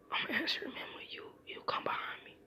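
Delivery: breathless hushed whisper, no voice at all. Retold or imitated. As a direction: Naruto whispering to Menma so the knocker won't hear. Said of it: "he whispers and he says".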